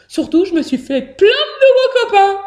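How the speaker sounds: high, loud, gleeful announcement; smiling. A narrator's reading. Hamster telling father of new friends, gleeful. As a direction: burst out happily with the good news. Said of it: "dit Billy souriant".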